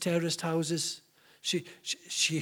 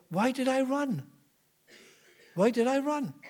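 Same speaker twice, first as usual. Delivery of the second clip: pitched up, baffled at herself; puzzled self-question; 7 semitones higher than usual. Retold or imitated. Woman wonders aloud why she fled, puzzled and pitched up. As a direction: ask it high, unable to understand why she ran.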